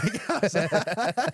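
high, loud laughing words. Real, not acted. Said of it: "That's funny".

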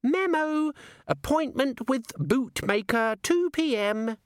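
high, slow sneering little imp; a tiny gadget imp reciting a memo; giggly sneering imp. A narrator's reading. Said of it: "said the imp".

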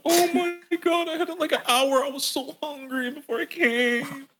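very high, gushing imitation; hammed-up high-pitched babbling; weird embarrassed voice. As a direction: gush in a squeaky put-on date voice. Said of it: "had the weirdest voice".

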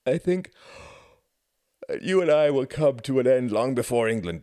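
drowsy reply half-swallowed by a yawn; yawning again. A narrator's reading. Husband teasing Ruth, yawning before bed. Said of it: "yawning again".

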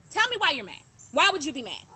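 usual speaking voice

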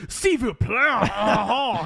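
high, mocking French teacher voice. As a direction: sneer it like a snooty teacher.

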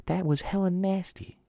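high, slow mock disgust; disgusted dog. Voicing the dog's thought after punishment, high drawn-out disgust. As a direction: draw it out as a grossed-out dog. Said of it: "then the dog thinks".

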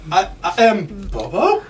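questioning rise on "Bobo"; mock unsure. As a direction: say "Bobo" as a hesitant question, pitch climbing at the end. Unsure of own name, joking.

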